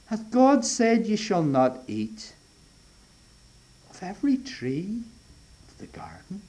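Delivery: sly, hushed serpent voice trailing into a whisper; insinuating serpent. Acted. Preacher voicing the serpent to Eve, sly hushed whisper. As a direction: plant the doubt softly, like the tempter. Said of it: "He's insinuating".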